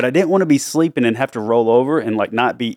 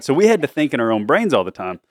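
ordinary talk

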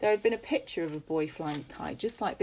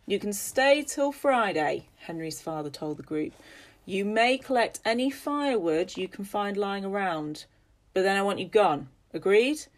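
stern landowner; 3 semitones higher, 4 dB louder than usual. Acted. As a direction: lay down the law. Father gives orders to travellers.

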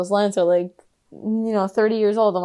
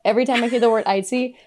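ordinary delivery

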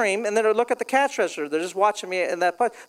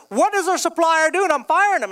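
angry owner; 6 semitones higher than usual. Retold or imitated.

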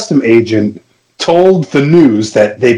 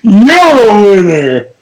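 laughing cheer; 11 semitones higher, slower than usual. Real, not acted. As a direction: cheer yourself on, cracking up. Declares himself winner laughing.

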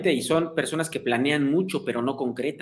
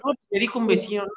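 defensive reply; 6 semitones higher, faster than usual. Retold or imitated. Friend answers the skeptic.

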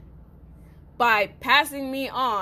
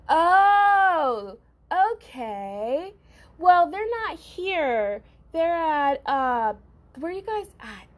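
mimicking the mother; 5 semitones higher, much slower than usual. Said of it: "She's like".

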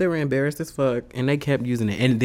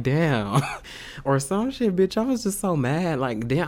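usual speaking voice

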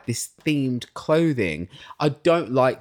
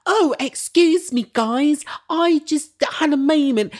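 very high, slow mock-dainty voice; exaggerated high-pitched send-up of her; mocking British woman; 4 dB louder, slower than usual. Podcaster mocking a housewife's British friend, high affected voice. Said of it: "she's all like".

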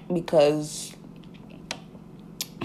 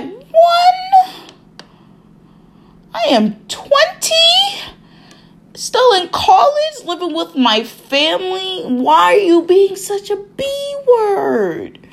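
much higher, slowed, half-crying exasperation; exasperated inner rant; 5 dB louder than usual. Retold or imitated. Patient in her head answering the nurse, exasperated and high. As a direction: wail it like you can't believe her. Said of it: "And in my head, I'm like".

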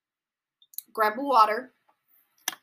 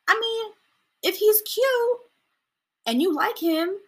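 fangirl voice; 12 semitones higher, 5 dB louder, slower than usual. Retold or imitated. High voice for the fan.

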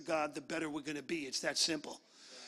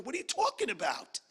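too-high exclamation; 2 semitones higher than usual. Acted. God answering someone's prayer, voiced a little too high. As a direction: voice jumping up high mid-question. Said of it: "that was a little high".

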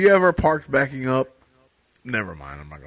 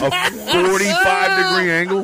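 high, laughing disbelief at the angle; squealing with laughter in disbelief. Real, not acted.

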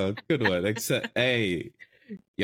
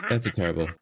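ordinary delivery

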